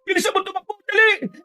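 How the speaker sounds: high-pitched panic on the run; frantic, high and urgent; panicked. Acted. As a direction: urge your friend to run for it. Friends flee from the monster.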